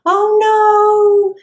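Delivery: very high, loud squeal; mock-scared worm. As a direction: shriek like a cartoon victim. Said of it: "This is exactly what happened".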